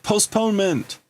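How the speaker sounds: high-pitched mock yell of the order; yelled command. The captain yells the order at the pursuing ships, a high mock yell. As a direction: bark the order up high, half-playing the captain. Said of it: "he yells out".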